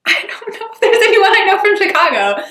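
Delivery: loud, high and laughing; laughing exclamation. Real, not acted. Student can't name anyone from Chicago, loud laughing exclamation. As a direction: blurt it out high through a laugh.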